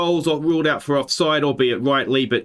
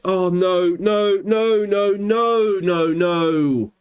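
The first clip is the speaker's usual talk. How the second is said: rising string of "no"; alarmed repeated "no, no"; dismayed; 4 semitones higher, much slower than usual. Real, not acted. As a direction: watch disaster unfold. Late chance conceded.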